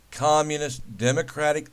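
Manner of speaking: ordinary talk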